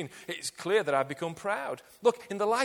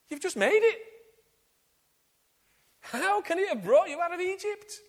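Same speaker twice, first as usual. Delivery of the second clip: higher, louder, disbelieving rise; incredulous challenge; 12 semitones higher, 5 dB louder than usual. Acted. Preacher confronts Aaron over the golden calf, incredulous and raised. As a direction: demand an answer, baffled at the absurdity.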